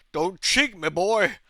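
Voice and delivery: imitated stern American official, loud; grumpy officer. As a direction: bark it like an officer on a power trip. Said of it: "he got really grumpy at me".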